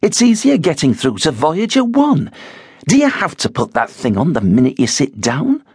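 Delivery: high, fed-up wifely nagging; exasperated wife. A narrator's reading. Wife scolding husband for his earphones, exasperated. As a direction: needle him with a weary jab. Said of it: "She sighed".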